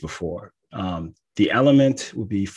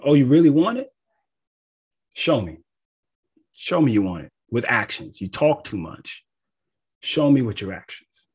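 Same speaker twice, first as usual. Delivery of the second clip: blunt challenge; 4 semitones higher, 5 dB louder than usual. Acted. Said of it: "That's Mars type talk".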